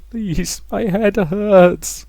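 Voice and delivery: high, drawn-out begging; pained plea. A narrator's reading. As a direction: plead through a splitting headache, slowly. Rosa begs the voice to stop.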